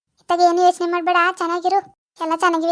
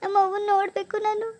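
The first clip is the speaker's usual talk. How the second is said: higher-pitched homesick whine; tearful; 4 semitones higher than usual. Acted. New bride begs husband to see her mother, tearfully. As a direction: plead like a homesick child near tears. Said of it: "ಹಿಂಗ್ ಅಳ್ತಾ ಕೂತಿದ್ದೀರಲ್ಲ".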